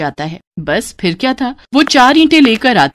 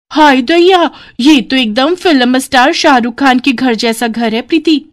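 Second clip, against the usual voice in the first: village bride gushing; 4 semitones higher than usual. Acted. New bride marvels at her in-laws' house, high and excited. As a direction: gasp at the mansion like a wide-eyed village girl.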